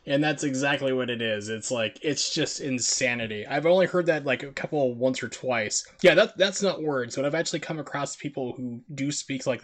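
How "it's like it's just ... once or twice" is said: normal talking voice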